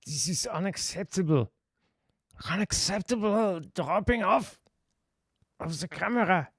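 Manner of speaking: mock-indignant in a put-on German accent; jokey German accent. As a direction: play up a comic German accent. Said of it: "in a German accent".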